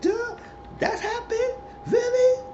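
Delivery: very high, incredulous little outbursts; mock invested listener. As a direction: imitate someone gobsmacked by gossip.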